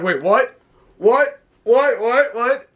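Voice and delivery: confused wrestlers reacting to Priest, stunned rising whats; shocked what.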